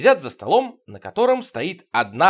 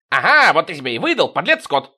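gloating; 4 semitones higher, 6 dB louder than usual. Acted. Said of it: "Потапов злорадно".

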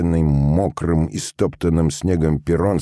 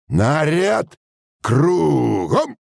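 high, drawn-out parade-ground call; drill command; 11 semitones higher, much slower than usual. A narrator's reading. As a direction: command the squad to turn, voice rising. Sergeant gives drill command. (строевая команда нараспев)

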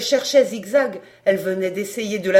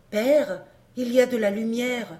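usual speaking voice

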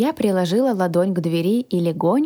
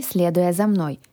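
ordinary delivery, nothing marked

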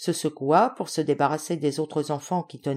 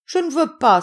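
put-on high, loud child's protest; acted little boy's frightened cry; 11 semitones higher, 8 dB louder than usual. A narrator's reading. Youngest child refusing to kiss the general, crying out. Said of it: "s'écria-t-il".

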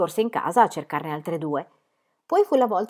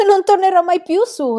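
high, loud, defiant-sounding declaration; calling back; 11 semitones higher, 9 dB louder than usual. A narrator's reading. Girl in hollow tree answers worried friend, high loud call. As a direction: call it up from down inside. Pippi calls from inside tree.

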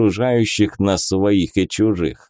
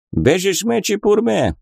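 gibberish migrant voice; 9 semitones higher than usual. Acted. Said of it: "Jamshut gibberish".

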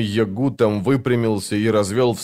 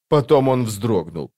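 ordinary delivery

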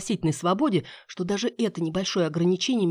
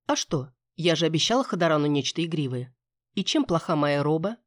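ordinary delivery, nothing marked

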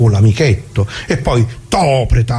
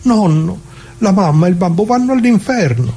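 put-on higher, child-like pleading voice; imitating a pleading grandson; 7 semitones higher than usual. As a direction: beg like a scared little grandson. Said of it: "mio nipote lì che mi guardava con gli occhi grandi grandi".

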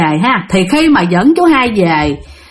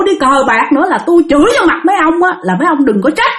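high-pitched scolding of the drinking buddies; acted-out indignant wife laying down a warning; angry hollering; 5 semitones higher than usual. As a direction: tell them off in a raised, heated pitch. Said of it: "Cho nên bà mới la làng".

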